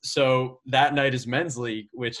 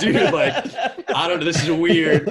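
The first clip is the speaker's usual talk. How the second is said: louder and higher, laughing, stunned; going berserk; 6 semitones higher, 7 dB louder than usual. Retold or imitated. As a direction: relive the shock of the hole in one. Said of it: "And we go berserk".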